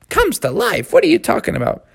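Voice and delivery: lightly voiced baffled young frog; incredulous Froggy. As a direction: question it in disbelief. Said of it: "Froggy said".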